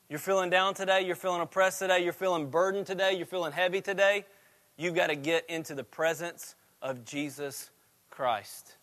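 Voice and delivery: ordinary talk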